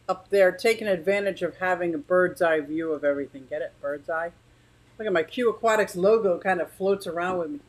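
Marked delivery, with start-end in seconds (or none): none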